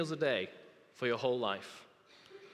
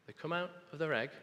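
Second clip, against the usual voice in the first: usual speaking voice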